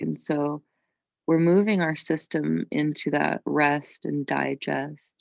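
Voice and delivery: calm yoga teacher with long, restful pauses; guided meditation. Real, not acted. Yoga teacher calming students in child's pose, guided meditation. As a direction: ease them into rest, unhurried.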